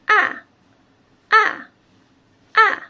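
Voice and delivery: ah starting high, falling sharply; fourth tone. Shown as a demonstration. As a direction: drop "ah" hard from the top. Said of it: "It go down".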